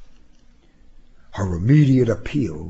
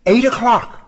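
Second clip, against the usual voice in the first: high-pitched call over the shoulder; high, carrying woman's voice; calling back; 13 semitones higher, 4 dB louder than usual. A narrator's reading. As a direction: call it back while leaving. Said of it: "she called back".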